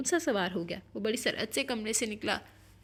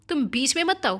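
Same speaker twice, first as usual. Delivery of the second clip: loud, higher-pitched warning off; raised, heated command; angry shout; 4 semitones higher, 8 dB louder than usual. A narrator's reading. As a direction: shout them out of the way. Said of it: "وہ شوکت پر بھی چلایا تھا".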